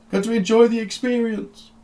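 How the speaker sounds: high, loud, delighted; gleeful eccentric doctor. Acted. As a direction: savour it out loud. GM voices Doctor Beige.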